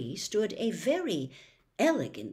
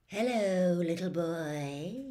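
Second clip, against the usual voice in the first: amiable; slower than usual. Acted. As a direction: greet the boy sweetly. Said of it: "he said, amiably".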